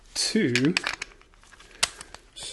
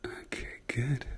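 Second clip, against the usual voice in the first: whispering; 11 dB quieter, slower than usual. Acted. ASMR doctor exam roleplay.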